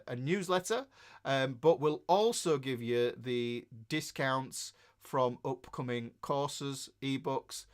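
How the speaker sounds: normal talking voice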